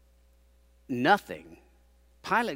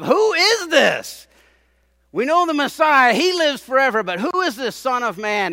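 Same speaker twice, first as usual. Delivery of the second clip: crowd shouting a question; 10 semitones higher, 11 dB louder than usual. Retold or imitated. Crowd demanding who the Son of Man is, loud and high. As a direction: bellow it as the baffled crowd. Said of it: "when they said".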